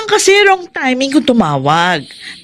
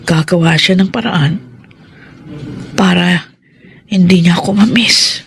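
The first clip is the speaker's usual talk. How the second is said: ordinary delivery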